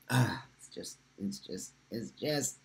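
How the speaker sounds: sighing, upset, faltering and quiet; low groan into halting, quiet words. Real, not acted. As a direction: trail off softly, too upset to finish. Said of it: "upsetting me".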